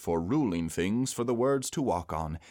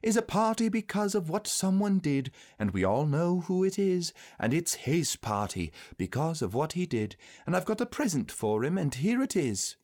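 put-on higher-pitched boy's speech; Christopher Robin giving a speech; 9 semitones higher than usual. A narrator's reading. Christopher Robin presenting a gift at the party, high and proud. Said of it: "said Christopher Robin".

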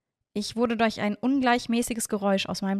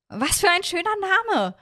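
high, louder, bright-spirited call; delighted exclamation; 10 semitones higher, 5 dB louder than usual. A narrator's reading. Hiro on hearing Miorin's name, delighted high exclamation. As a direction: exclaim it with delight, pitched well up. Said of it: "rief Hiro".

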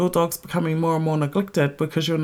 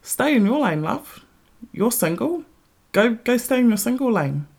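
sassy telling-off; 7 semitones higher than usual. Real, not acted. Telling off a single woman.

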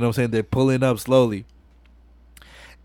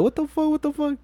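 much higher, squeaky mock disbelief; kid's mock shock; 13 semitones higher, 5 dB quieter than usual. Little kid watching the titty scene, high mock shock. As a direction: yelp it like a startled little kid.

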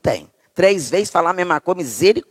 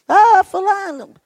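high, drawn-out call, hammed up; mock crazed yell; 12 semitones higher, 4 dB louder, slower than usual. Mother calling from kitchen to bathroom, mock crazed yell. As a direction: imitate yelling across the house like a madwoman. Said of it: "gritando lá da cozinha que nem uma louca".

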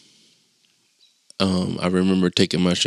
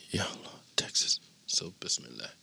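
whispered; 2 semitones lower, 16 dB quieter than usual. Real, not acted. Muttered as big trucks pass.

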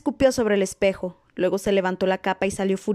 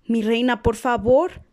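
pleading cry; 3 semitones higher, 4 dB louder, slower than usual. A narrator's reading. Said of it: "Verona gritó".

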